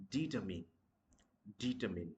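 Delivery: determine ending in a short "min"; correct pronunciation. Shown as a demonstration. Teacher giving learners the right form, determine with short "min". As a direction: say determine as "DEE-tuh-min", falling. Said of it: "That's going to be".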